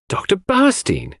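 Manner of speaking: high surprised exclamation of the name; exclaiming. A narrator's reading. Cynthia exclaims at the doctor's arrival, surprised. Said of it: "exclaimed Cynthia".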